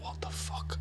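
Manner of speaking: hushed whisper, dumbfounded; whispered disbelief. Retold or imitated. Called an angel by Jeff, whispering in disbelief. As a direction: breathe it out in shock. Stunned as man thanks him.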